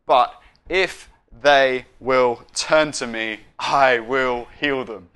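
deliberate word-by-word delivery; measured scriptural proclamation; slow, weighty quote. Retold or imitated. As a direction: spell out the promise slowly. Quoting the climactic last line.

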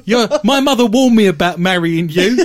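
nagging Bigfoot spouse, loud and laughing; Bigfoot impression. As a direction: scold like a henpecking Bigfoot wife. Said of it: "That's the best Bigfoot impression you're gonna get".

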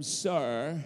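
lightly put-on British accent. Preacher voicing Mary addressing the gardener, British accent. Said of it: "I think she now became British".

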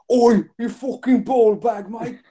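high, slow mock rough British lad. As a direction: bark it like a yobbish Brit. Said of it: "Or will it be".

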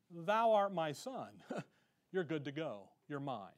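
soft, low words of reassurance, slowing down; reassuring. Acted. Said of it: "The servant needs to be reassured".

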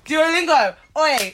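loud, high hype call; excited shout. Real, not acted. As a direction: shout it out like a fan. Excited shout-out of favourite app.

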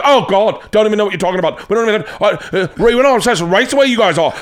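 high, loud, flustered British host; mock upset. British host objecting to comic's joke, mock upset. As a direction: imitate a Brit protesting in high-pitched dismay. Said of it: "like, really upset".

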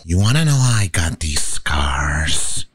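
low whispered Joker menace. Shown as a demonstration. Joker telling how he got the scars, whispered. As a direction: lean in and whisper the threat.

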